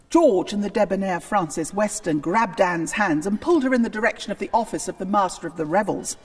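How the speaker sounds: plain reading voice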